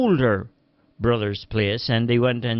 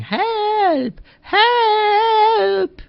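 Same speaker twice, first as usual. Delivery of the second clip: high, far-off call of help; high desperate calling for help; distant cry for help; 4 dB louder than usual. A narrator's reading. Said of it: "a voice calling".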